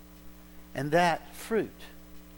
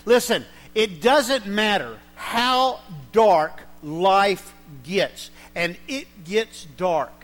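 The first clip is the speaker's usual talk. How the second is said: preaching loudly; 5 semitones higher, 11 dB louder than usual. Real, not acted. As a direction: drive it home to the congregation. Preacher driving the point home.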